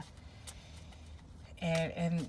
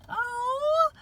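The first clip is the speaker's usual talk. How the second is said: very high, tearful little gasp; moved to tears like a small child; touched toddler voice. Retold or imitated. Feeling the reading's love, she voices the touched child, very high and tearful. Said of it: "it's like that".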